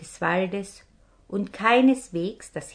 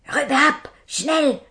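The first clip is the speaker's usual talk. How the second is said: hissed order; 7 semitones higher, 6 dB louder than usual. Acted. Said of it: "zischte der Hauptmann nochmal".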